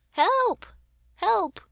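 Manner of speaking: loud, high desperate yells; shouting for help. Acted. Trapped head yelling up to the ship for help. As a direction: scream it out for rescue. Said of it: "cried a voice from below".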